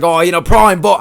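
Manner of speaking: lightly put-on Australian accent. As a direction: slip into a slight mock Australian accent. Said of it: "doing the Australian accent".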